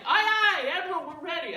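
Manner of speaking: loud, high crew's reply; mock sailors shouting back; eager crew. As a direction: answer the admiral with a hearty call. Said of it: "we're like".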